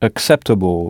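Acceptable with English sounds, stress on CEP; teacher repeats Acceptable in English; English pronunciation. Shown as a demonstration. Teacher confirming the English Acceptable. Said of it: "comment direz-vous acceptable?".

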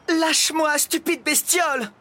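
loud, very high indignant yell; struggling cry. A narrator's reading. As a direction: cry out while fighting to get free. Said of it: "criait la prisonnière en se débattant". (cri indigné de prisonnière qui se débat)

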